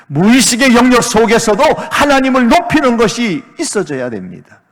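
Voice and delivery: high, loud, driving sermon delivery; impassioned pulpit shout; full-force preaching. Acted. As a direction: preach it out at full volume, pressing each phrase.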